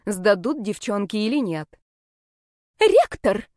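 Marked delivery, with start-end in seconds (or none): 2.8-3.5 high, loud shriek; squeal. A narrator's reading. Girl squeals to the rector to tell on the heroine, high squeal. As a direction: squeal it out in outrage. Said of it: "взвизгнула мамия".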